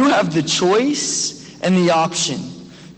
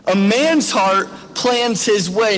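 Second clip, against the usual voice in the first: slow, weighty repeat of the verse; slow emphasis; slower than usual. Shown as a demonstration. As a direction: let each word land slowly. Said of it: "I wanna be really clear on this".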